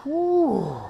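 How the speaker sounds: high breathy whew after effort; high exhale. Real, not acted. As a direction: blow out the strain. Exhale after heavy set.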